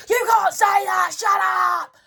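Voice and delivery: high, loud mock shout; retold scream. Imitating her friend's boyfriend shouting in the car, loud and high. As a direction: belt it like the angry boyfriend. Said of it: "literally started screaming at me".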